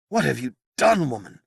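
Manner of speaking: high, loud shocked cry fading off; shocked demand. Acted. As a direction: confront her, aghast. Merlin confronts Igraine.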